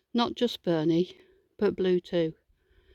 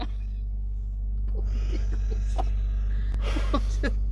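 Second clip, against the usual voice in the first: long breathy giggle, breaking character; can't hold it together, giggling; corpsing; 7 semitones higher than usual. Real, not acted. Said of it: "Call it corpsing".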